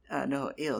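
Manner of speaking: normal talking voice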